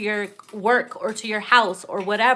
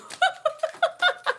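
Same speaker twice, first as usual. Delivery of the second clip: high laugh. Real, not acted. Cracking up.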